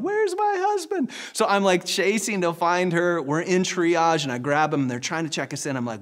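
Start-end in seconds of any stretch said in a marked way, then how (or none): 0.0-1.1 high mock wife calling from afar. Wife in labor calling for her husband down the hall, high mock call. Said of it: "my wife in the distance going".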